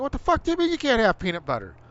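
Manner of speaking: exasperated yelled question; outraged disbelief. Retold or imitated. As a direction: blurt it out in angry disbelief.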